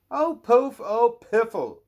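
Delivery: high, loud stamping princess huff; cross princess. A narrator's reading. Princess stomping her foot in a tree, huffing. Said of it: "she said, as she stomped her foot in anger".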